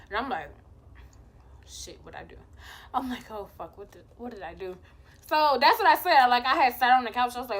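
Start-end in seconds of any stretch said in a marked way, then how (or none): 1.7-2.4 very soft, low uh-oh aside; worried mutter. Retold or imitated. Told "we need to talk", worried low mutter. 3.3-4.8 very soft, low uh-oh aside; worried mutter. Retold or imitated. Told "we need to talk", worried low mutter.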